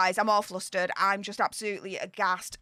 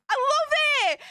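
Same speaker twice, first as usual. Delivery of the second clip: gleeful shout; slower than usual. Real, not acted. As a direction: cheer it out with delight. Gleeful at poll results.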